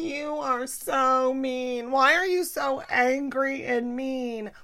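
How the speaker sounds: slow, whining complaint in a put-on voice; slow whining mom voice; whiny mom. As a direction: sulk like a hurt mother. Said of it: "my mom's like".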